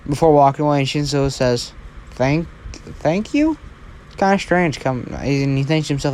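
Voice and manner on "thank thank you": hesitant, rising question; stammered thanks, pitched way up at the end; unsure, questioning thank-you; puzzled. Acted. Puzzled reply to odd compliment.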